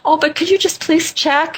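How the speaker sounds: high-pitched, coaxing plea; sweet pleading. Retold or imitated. Guest retelling how she pressed the innkeeper, high pleading. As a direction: beg nicely, voice lifted high.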